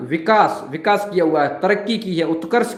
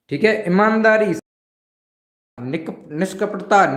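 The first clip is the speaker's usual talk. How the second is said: normal talking voice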